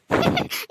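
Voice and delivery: loud breathy laugh; burst of laughter. Acted. Wife bursts out laughing at her husband, loud breathy laugh. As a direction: laugh out at the silly offer.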